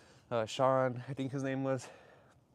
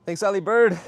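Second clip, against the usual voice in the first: loud, raised thank-you call; 5 semitones higher, 10 dB louder than usual. Real, not acted. Thanking chat member.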